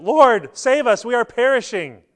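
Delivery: high, loud desperate plea for rescue; panicked cry. Acted. Frightened disciples crying out to Jesus in the storm, loud panicked plea. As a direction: cry out as if the boat is sinking. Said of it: "they cry out to him".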